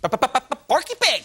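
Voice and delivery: slow stuttering cartoon pig voice; Porky Pig stutter. Host imitates Porky Pig stutter.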